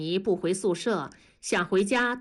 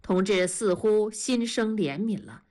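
ordinary delivery, nothing marked

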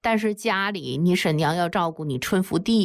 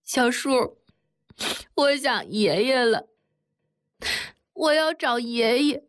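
put-on child crying for her grandpa, pitched high; a hurt girl wailing that she wants grandpa; higher tearful plea; 6 semitones higher than usual. A narrator's reading. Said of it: "哭着对铁蛋儿说".

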